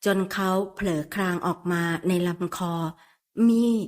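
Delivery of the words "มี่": a drawn-out trembling moan of her name; breathless soft moan. A narrator's reading. Man moaning his lover's name softly in the throat. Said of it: "เสียงครางกระเส่าดังในลำคอแผ่วเบา".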